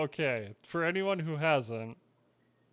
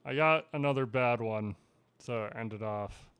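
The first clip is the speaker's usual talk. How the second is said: usual speaking voice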